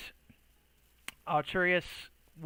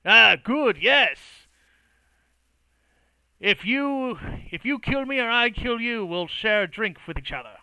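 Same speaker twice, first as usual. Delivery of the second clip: high and loud, hearty cheer; jovial boisterous toast; 6 semitones higher, 9 dB louder than usual. Acted. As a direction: raise a glass loudly. Dralbin's jovial drinking toast.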